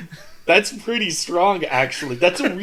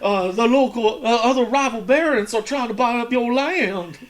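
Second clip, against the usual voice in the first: servant voice; 8 semitones higher than usual. Pretend. Servant in oil baron skit.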